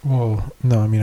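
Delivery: ordinary delivery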